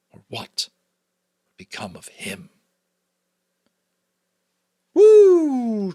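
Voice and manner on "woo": loud, high whoop of excitement; thrilled reader whooping at chapter end; excited whoop. Real, not acted. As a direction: let out a big joyful woo.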